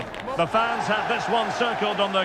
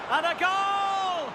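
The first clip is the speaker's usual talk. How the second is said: goal shout; 9 semitones higher than usual. Real, not acted. Commentator roaring the striker's second goal to viewers. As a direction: belt it out as the striker scores.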